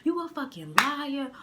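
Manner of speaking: heated rant, imitating her yell; retold yelling. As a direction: act out a girlfriend blowing up. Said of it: "So she's yelling. She's like".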